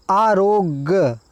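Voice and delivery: आरोग्य drawn out slowly, syllable by syllable; slow spelling out. Shown as a demonstration. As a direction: say आरोग्य slowly and clearly for the class. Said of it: "ये जो आरोग्य लिखा है".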